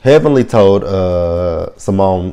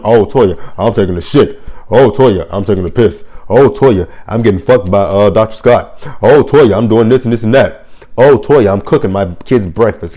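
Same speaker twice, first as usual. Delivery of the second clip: hammed-up sarcastic updates, each "Oh, Toya" called out louder; mock oversharing; 4 dB louder than usual. Host mocking Toya for wanting texts, hammed-up sarcastic calls. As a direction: parody someone reporting every little thing.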